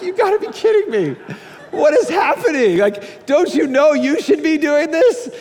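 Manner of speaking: high incredulous voice with laughter; laughing disbelief. Retold or imitated. Pastor reacting to cheering neighbors, laughing disbelief.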